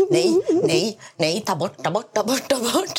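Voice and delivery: high, frantic begging to delete it; panicked pleading. Retold or imitated. Retelling waking to thousands of followers, panicked squeal. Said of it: "Jag blev livrädd".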